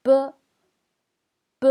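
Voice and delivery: "B" as a short Chinese "buh"; pronunciation demo of B. Shown as a demonstration. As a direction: say "B" as a brief, punched syllable with little air. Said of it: "ça se prononce".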